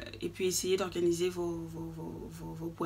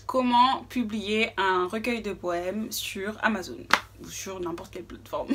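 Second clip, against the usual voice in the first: normal talking voice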